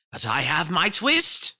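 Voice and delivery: put-on loud, slow happy old tailor; relieved old tailor. A narrator's reading. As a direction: say it like a grateful old man. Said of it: "said the Tailor of Gloucester".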